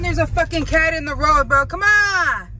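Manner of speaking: high, loud exasperated cry at the cat; exasperated yell. Real, not acted. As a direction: yell it at the cat in the road, fed up. Cat blocking road while driving.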